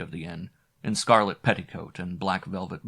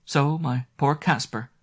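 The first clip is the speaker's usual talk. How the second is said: tender; 6 semitones higher than usual. Acted. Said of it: "she added with a tender glance".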